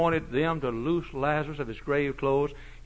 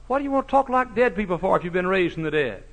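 ordinary talk

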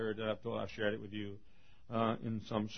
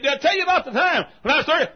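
high, fast preacher shout; shouted retelling; 14 dB louder, faster than usual. Acted. Old doctor restarting his favorite story, high fast shout. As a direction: launch into it loud and rushed.

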